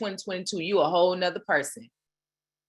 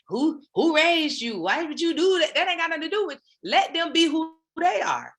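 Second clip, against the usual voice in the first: mock scolding; 9 semitones higher than usual. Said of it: "mock scolding the listener".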